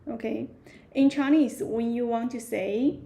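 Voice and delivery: ordinary talk, nothing marked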